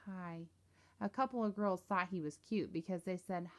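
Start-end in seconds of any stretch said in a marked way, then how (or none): 0.0-0.5 barely audible shy hi; soft and withdrawn; real quiet. A narrator's reading. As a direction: mumble it, eyes down. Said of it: "said real quiet".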